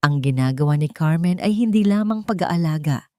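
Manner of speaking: plain reading voice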